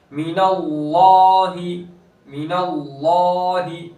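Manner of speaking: slow chant of मिनल्लाहि with a heavy लाम; tajweed recitation. Shown as a demonstration. Teacher recites the example slowly. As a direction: draw the word out in recitation. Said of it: "यहाँ पर भी वैसे ही".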